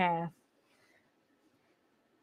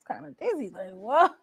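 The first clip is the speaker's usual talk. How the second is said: much softer, dazed repeat of dizzy; dazed echo; 6 dB quieter than usual. Shown as a demonstration. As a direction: echo it to yourself, stunned. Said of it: "Had us kinda dizzy".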